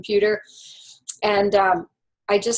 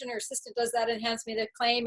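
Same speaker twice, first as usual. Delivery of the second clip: usual speaking voice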